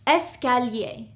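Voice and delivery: escalier said in French as "es-ka-lyay"; escalier, French pronunciation, three clean syllables; French word. Shown as a demonstration. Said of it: "The first one is".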